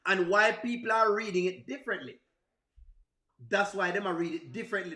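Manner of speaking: normal talking voice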